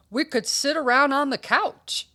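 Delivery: inviting dog. Acted. Stanley pitches party to friend.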